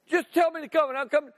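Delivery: can't wait to jump out; eager. Pretend. Eager reply to Jesus.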